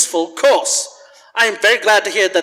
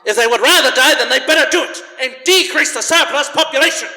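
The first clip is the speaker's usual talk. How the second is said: scornful outburst; 7 semitones higher, faster than usual. Acted. Scrooge to the charity men, mocking the dying poor. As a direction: sneer it with a harsh laugh.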